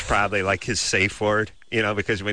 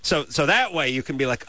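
ordinary talk, nothing marked

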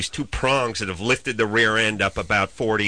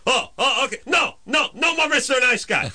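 mocking the tow driver; 12 semitones higher than usual. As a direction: ham up his huffy warning, pitched way up. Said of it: "I love when assholes do this".

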